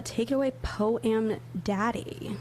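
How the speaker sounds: ordinary talk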